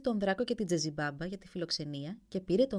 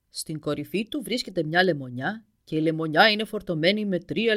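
huge dragon reassuring the prince, much louder; booming dragon; 8 dB louder than usual. Acted. Dragon gives the prince directions.

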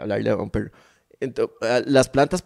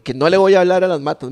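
loud, higher protest; exasperated refusal; 6 semitones higher, 6 dB louder than usual. Retold or imitated. Friend refuses loudly to talk to houseplants, indignant shout. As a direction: bark it out in disbelief. Insisting again, louder.

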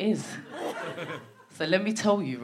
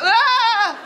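startled squeal; 10 semitones higher, 4 dB louder than usual. Retold or imitated. Mock reaction to posh girl.

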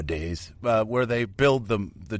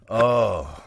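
usual speaking voice

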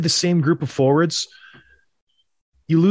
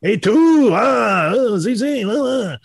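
hammed-up French accent gibberish; exaggerated mock French accent; 4 semitones higher, slower than usual. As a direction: play a cartoon Frenchman. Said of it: "Put the, the French accent on it".

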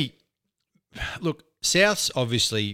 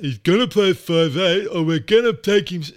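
mocking dirtbag manager; 6 semitones higher, 6 dB louder than usual. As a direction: sneer it like a pushy agent. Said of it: "his dirtbag manager comes out".

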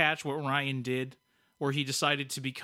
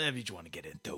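a hint of an old New York cop, lower and softer; tough-guy "Eh", lightly imitated; 1930s New York cop; 7 semitones lower, 8 dB quieter, faster than usual. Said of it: "like, nineteen thirties Chicago or New York cop".

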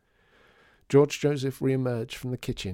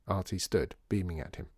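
ordinary delivery

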